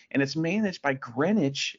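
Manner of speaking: usual speaking voice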